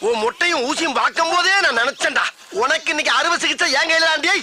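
loud furious scolding, pitched high; angry yelling. Acted. Man yelling at the swindling old woman, furious. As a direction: yell at her, furious and high.

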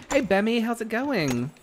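ordinary delivery

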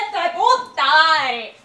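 loud curse; cursing shout. Retold or imitated. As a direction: yell the curse out. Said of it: "porque decía".

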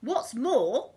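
high, loud villain voice; Len the crook. A narrator's reading. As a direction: add a complaint in character. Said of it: "said Len".

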